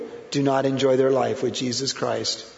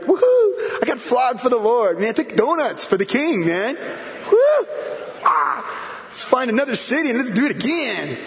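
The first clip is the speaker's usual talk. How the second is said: joyful whooping; 8 semitones higher than usual. Retold or imitated. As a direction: whoop it up like a flogged apostle skipping for joy. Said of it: "they went skipping and leaping".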